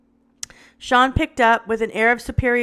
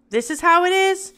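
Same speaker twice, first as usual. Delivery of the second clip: higher, louder, anger creeping in; rising anger; 8 semitones higher, 4 dB louder than usual. A narrator's reading. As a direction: let the threat seep through. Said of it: "Sean's anger was creeping in".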